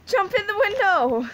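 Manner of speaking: high squeaky game-character voice. Pretend. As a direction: pipe it up like a cartoon kid begging. Voicing character at window, laughing.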